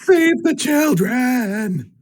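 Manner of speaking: very high, drawn-out battle cry; acted scream. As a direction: stretch out a hammed-up heroic scream. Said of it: "I scream out".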